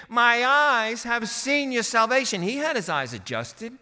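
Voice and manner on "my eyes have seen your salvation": high, loud cry of elation; ecstatic exclaiming. Acted. Preacher acting Simeon seeing the Messiah, ecstatic exclaiming. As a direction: proclaim it, carried away with joy. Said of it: "almost ecstatic and exclaiming".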